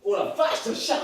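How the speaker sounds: startled gasp at the cat. Pretend. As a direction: jump at a sudden fright. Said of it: "il m'a fait peur".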